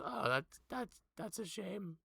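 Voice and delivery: high, awkward character voice; high-pitched voice. Acted. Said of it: "watch as he goes".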